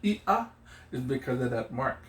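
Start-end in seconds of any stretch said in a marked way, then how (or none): 0.0-0.5 "Ia" split into ee and ah; hiccup between vowels. Shown as a demonstration. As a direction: separate the two vowels of "Ia" with a catch. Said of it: "make a hiccup or a little separation".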